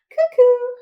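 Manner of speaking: high loud cuckoo; shrill-pitched loud cuckoo call. Shown as a demonstration. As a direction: call out like a clock bird. Cuckoo clock call in song.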